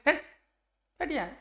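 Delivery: sharp dismissive scold; a teacher demoing a curt scolding. Shown as a demonstration. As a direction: shoo someone away with contempt. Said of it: "ऐसे डांटते अपन".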